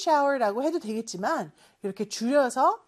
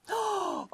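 sharp surprised gasp; shocked intake of breath; 8 semitones higher, 6 dB quieter than usual. Acted. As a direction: gasp at the mess. Teacher reacts to dirty picture.

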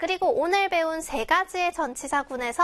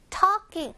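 "talking" high on the first syllable, then falling; stressed talking; 5 semitones higher than usual. Shown as a demonstration. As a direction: punch the start of "talking" higher. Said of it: "강세".

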